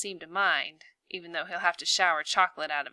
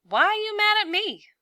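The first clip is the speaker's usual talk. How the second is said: upset cry; 8 dB louder than usual. Acted. Sabine upset, hurt by Ben.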